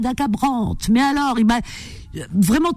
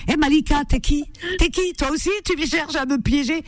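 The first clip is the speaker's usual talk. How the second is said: higher-pitched playful challenge; teasingly suspicious, pitched up; mock suspicion; 5 semitones higher than usual. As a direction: pretend to grill a suspected prankster. Playfully challenges caller as impostor.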